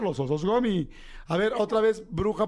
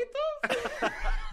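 high squeaky cartoon mouse giggle; Mickey Mouse laugh; 3 dB quieter than usual. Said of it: "cuando hace Mickey Mouse". (risita aguda de Mickey Mouse)

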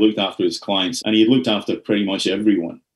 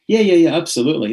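quick, higher-pitched run of yeses; eager agreement; 6 semitones higher, faster than usual. Retold or imitated. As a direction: agree readily in a rush. Acts own eager phone agreement.

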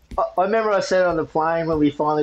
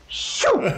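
high whoosh of someone flying past; whooshing shoo. Retold or imitated. Describing his mate sprinting past him, whoosh sound. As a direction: mimic a runner zooming by.

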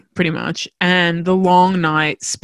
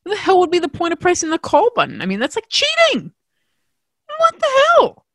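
high, fast exasperated rant; indignant, voice shooting up high; outraged disbelief; 12 semitones higher than usual. Real, not acted. Outraged rant about call button.

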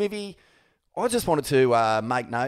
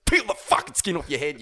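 inner threat; 2 semitones lower than usual. Retold or imitated. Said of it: "deep down, he's going".

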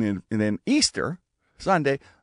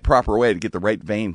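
ordinary delivery

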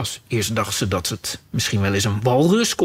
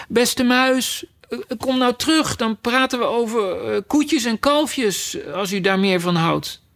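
softly coaxing call; 8 semitones higher than usual. A narrator's reading. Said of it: "riep ze hem zacht achterna".

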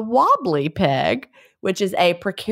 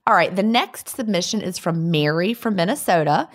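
ordinary talk, nothing marked

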